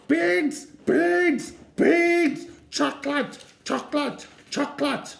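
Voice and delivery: put-on high, loud, wailing toddler chant; toddler screaming. Little Eddie screaming for beans in the supermarket. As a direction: scream it like a furious little boy.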